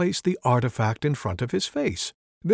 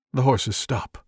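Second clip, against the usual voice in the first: plain reading voice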